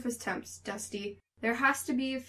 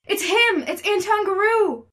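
very high, loud cry of terror; frantic high-pitched alarm; panicked shriek; 5 dB louder than usual. Acted. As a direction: cry out a warning while fleeing in fright. Mary shrieks and flees in terror.

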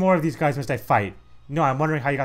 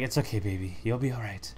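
tender comforting; 6 dB quieter than usual. Retold or imitated. Bowser comforting the beaten crow, soft and tender. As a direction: soothe a fallen friend. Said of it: "he was like".

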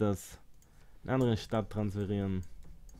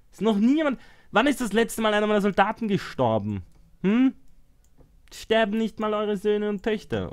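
higher, louder, scolding the people; mock ranting ruler; 9 semitones higher, 6 dB louder than usual. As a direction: berate the complaining citizens like a tyrant. Scolding his whining citizens. (spöttisch schimpfender Herrscher, höher und lauter)

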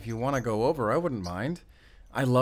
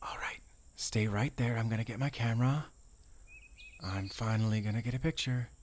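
soft hushed voice, barely voiced; whisper; 8 dB quieter than usual. Ranger telling friend to hold still, soft whisper. Sneaking a photo of crow.

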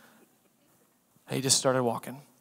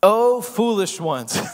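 slow, higher, louder Oh, foolish ones; mild fond rebuke; 6 semitones higher, 4 dB louder, slower than usual. Retold or imitated. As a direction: draw it out like a teasing scolding. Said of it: "This is what Jesus says".

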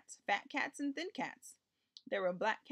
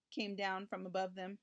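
usual speaking voice

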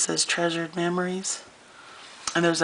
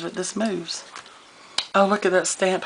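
ordinary delivery

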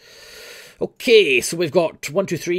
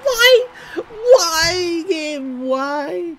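anguished wail; 7 dB louder, much slower than usual. Real, not acted. Wailing at the game.

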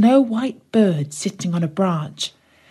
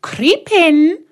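much higher, louder crone's coax; coaxing witch; 10 semitones higher, 4 dB louder than usual. Acted. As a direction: lure the girl into the oven. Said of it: "said the witch".

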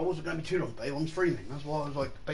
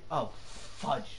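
ordinary talk

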